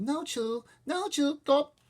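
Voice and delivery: put-on high, loud sing-song baby talk; toddler babble. Toddler babbling to his mother, gleeful. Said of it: "Fudge babbled".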